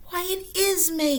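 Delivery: very high, loud joyful cry; delighted cry. Acted. Toad sees the calendar, high delighted cry. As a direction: cry out in glad surprise. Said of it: "cried Toad".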